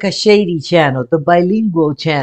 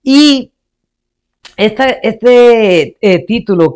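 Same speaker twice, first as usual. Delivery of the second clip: ordinary talk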